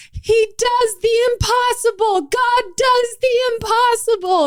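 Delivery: high, moved testimony; tearful praise. Retold or imitated. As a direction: marvel through tears at God's power. Crying waitress praises God.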